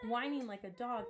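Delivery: ordinary delivery, nothing marked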